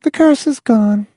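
put-on high, casual girl voice; matter-of-fact. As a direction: state it matter-of-factly. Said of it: "matter-of-factly".

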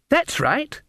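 put-on high, chirpy child; bright little girl reply. A narrator's reading.

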